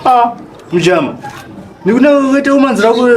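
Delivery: ordinary delivery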